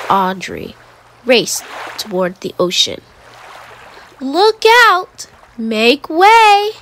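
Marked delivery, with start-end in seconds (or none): 4.2-6.7 put-on high, loud call of kids charging past; excited shout. Children warning beachgoers, loud excited shout. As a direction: yell it as you run for the water. Kids racing toward the ocean.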